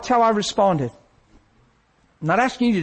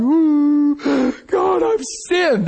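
bawling; 6 semitones higher, much slower than usual. Retold or imitated. Preacher reenacting his crying at the urinal, bawling. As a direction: imitate bawling out a confession. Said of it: "I'm bawling".

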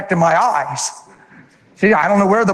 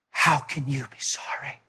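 whispered, softer disbelief; 5 semitones lower, 12 dB quieter than usual. Retold or imitated. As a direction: breathe it out in wonder. Husband tells wife softly.

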